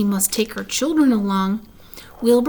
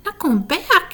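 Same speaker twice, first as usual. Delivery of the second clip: high, startled outcry; a pig jolted by terrible news; shocked cry; 8 semitones higher than usual. A narrator's reading. Wilbur crying out at Charlotte's news, shocked. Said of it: "he cried".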